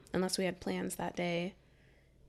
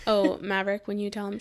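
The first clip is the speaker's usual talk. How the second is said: usual speaking voice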